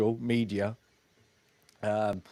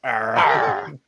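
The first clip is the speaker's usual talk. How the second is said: louder pirate arr; 6 dB louder than usual. Pretend. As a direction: growl it like a pirate. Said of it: "R like a fucking pirate".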